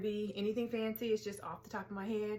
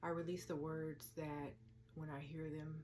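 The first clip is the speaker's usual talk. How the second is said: soft affirmations with long pauses; quiet, unhurried meditation voice; guided meditation; 8 dB quieter than usual. Acted. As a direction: speak each release gently, then let it settle. Leads a release meditation.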